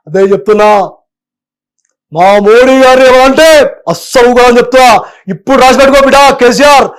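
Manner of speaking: loud, aggressive rally speech; mimicking Bandi Sanjay warning KCR, loud and aggressive; aggressive Bandi Sanjay impression. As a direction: bark out threats like a fiery politician.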